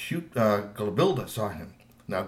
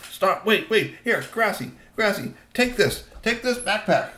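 higher-pitched, hurried-sounding instructions; urgent teen troll; 8 semitones higher than usual. A narrator's reading. Teen troll handing backpack to his friend, urgent. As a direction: urge your friend to take it.

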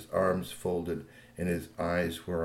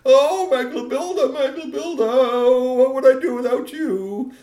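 high, loud weeping with relief; sobbing relief; 9 dB louder, slower than usual. Acted. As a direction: cry over her like a frantic dad. Father clutches his daughter.

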